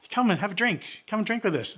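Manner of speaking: high-pitched imitation of a sociable local; inviting local man. Drinker invites the visitor to join them, high inviting voice. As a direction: coax the visitor over, pitched well up.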